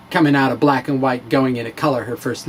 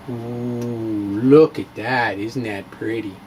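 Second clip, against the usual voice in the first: soft, lower, hushed admiration; admiring hush; 6 semitones lower, 4 dB quieter, slower than usual. Acted. Showing viewers the pristine lunchbox, hushed and admiring. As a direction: coo over it like a treasure.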